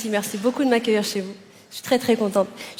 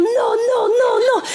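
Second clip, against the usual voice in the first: mock panicked cry; 10 semitones higher, much slower than usual. Boyfriend running through the flat crying out, panicked wail. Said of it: "en criant".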